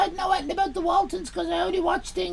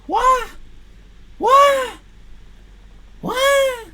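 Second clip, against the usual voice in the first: mock surprise; 6 semitones higher, 7 dB louder, much slower than usual. Exaggerated repeated What.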